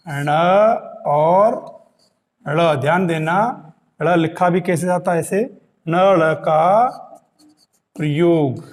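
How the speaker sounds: ordinary talk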